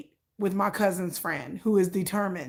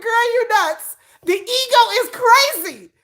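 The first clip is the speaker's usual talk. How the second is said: loud teasing; 12 semitones higher, 6 dB louder, slower than usual. Retold or imitated. Teasing friend's ego.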